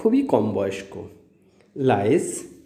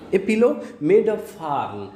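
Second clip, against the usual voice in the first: drawn-out verse line, each word slow; slow poem reading; 4 semitones higher, much slower than usual. Shown as a demonstration. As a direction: recite the line slowly for the class. Recites poem line slowly.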